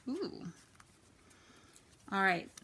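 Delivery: ordinary talk, nothing marked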